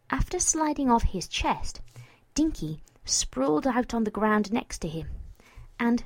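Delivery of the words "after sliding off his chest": usual speaking voice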